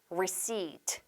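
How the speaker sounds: receipt said softly with a long ee, silent P; long vowel. Shown as a demonstration. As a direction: stretch "receipt" into re-SEEET, no P. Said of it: "장음으로". (receipt를 '리시-트'로 부드럽게 장음 발음)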